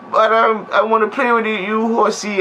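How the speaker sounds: put-on high, fast, pleading like a spoiled child; whiny childish plea. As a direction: beg like a kid who wants to play. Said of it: "she's like".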